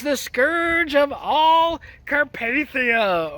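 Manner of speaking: slow, drawn-out villain quote; a hint of a movie villain proclaiming; lightly mock-ominous movie line; quoting Vigo. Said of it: "or whatever the hell that quote is".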